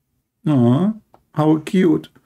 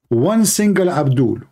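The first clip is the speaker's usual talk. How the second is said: ordinary talk, nothing marked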